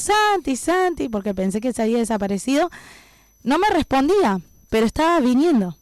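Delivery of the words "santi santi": retold calling all over the place; imitated searching call. Sister searches everywhere for missing brother, imitated calling out. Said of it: "yo gritando por todos lados".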